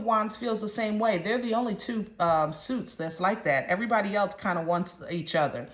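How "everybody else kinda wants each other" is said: ordinary talk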